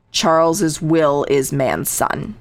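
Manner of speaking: slow, drawn-out, each word spaced; a retold slow, deliberate reading of the alias; very slowly. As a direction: spell the name out slowly, like a hidden meaning. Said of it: "very slowly".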